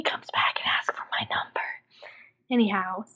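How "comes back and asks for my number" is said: whispering. Real, not acted. Hopeful aside to viewers.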